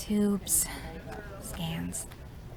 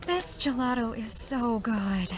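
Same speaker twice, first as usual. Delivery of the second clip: ordinary delivery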